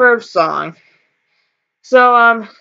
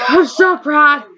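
heart pounding, sighing, shaky pride; adrenaline shakes; 4 semitones higher, slower than usual. Real, not acted. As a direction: breathe through the adrenaline rush. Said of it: "My heart is pounding".

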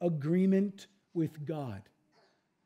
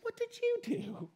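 much higher and softer, pointed YOU; pointed repeat question; 12 semitones higher, 9 dB quieter than usual. Acted. God re-asks evasive Adam, high, soft and pointed on YOU.

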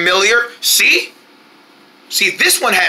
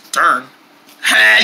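ordinary talk, nothing marked

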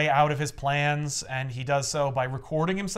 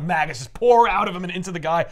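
ordinary delivery

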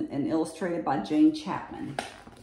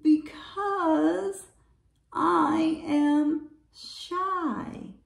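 high, very slow, timid reply; bashful little quavering voice; trembling voice; 9 semitones higher, much slower than usual. A narrator's reading. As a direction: draw it out shyly, high and trembling. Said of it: "a trembling voice says".

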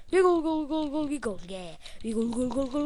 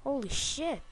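ordinary talk, nothing marked

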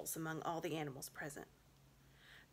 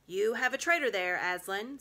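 cold, louder accusing witch voice; 4 semitones higher, 9 dB louder than usual. A narrator's reading. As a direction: throw the accusation like a challenge. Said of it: "said the witch".

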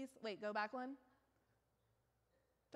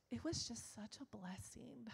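breathy imitating whisper of a gentle mother; mock sweet and pure, whispered; imitating her mother's soft praise, whispered; 6 dB quieter than usual. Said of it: "she is, like, so sweet and pure".

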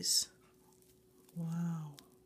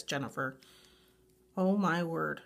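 normal talking voice

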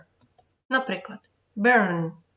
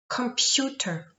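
Computer repeated slowly and higher; 4 semitones higher than usual. Shown as a demonstration. Teacher repeating word slowly for pupils. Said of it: "вимовляється як Ю".

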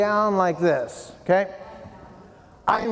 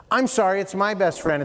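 ordinary talk, nothing marked

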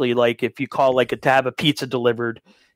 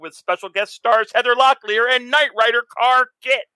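loud, high hyped ad announcer; monster truck ad; 10 semitones higher, 3 dB louder than usual. Acted. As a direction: shout it like a radio truck-rally ad.

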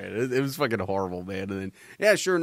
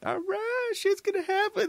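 much higher, squeaky narrator voice; high-pitched mock voice. Friend retelling the movie, squeaky high-pitched mock voice. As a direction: chirp it like an excited cartoon. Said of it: "It's like".

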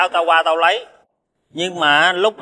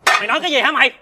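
sharply higher-pitched angry question; indignant challenge; 8 semitones higher, much faster than usual. Acted. Friend taking offence at an insult, indignant. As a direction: demand to know what he just said.

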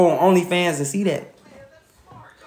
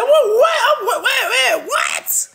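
high, laughing disbelieving shouts; shocked shout. Retold or imitated. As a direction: yell in disbelief, cracking up. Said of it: "I said".

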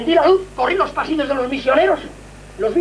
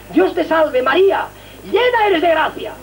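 prayer recited; 4 semitones higher, slower than usual. Retold or imitated. Reciting Hail Mary as weapon.

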